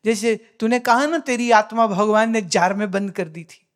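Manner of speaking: normal talking voice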